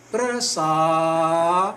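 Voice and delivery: "Prasadi" very slow, long "saa" then a clear "dee"; "Prasadi" drawn out syllable by syllable; reading with final I. Shown as a demonstration. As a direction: stretch the middle of "Prasadi" and sound the last i. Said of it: "Let me show you how we are reading".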